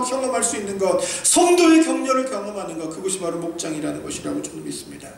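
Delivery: usual speaking voice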